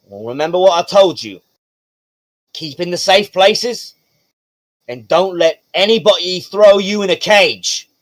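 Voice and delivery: loud, high, emphatic dad warning; protective father figure. Acted. Father warning his daughter about the fighting rings, forceful parental instruction. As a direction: lay down the rules as a game-character dad.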